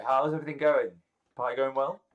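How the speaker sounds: loud, high, would-be casual check-in; David. Acted. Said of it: "so he's like".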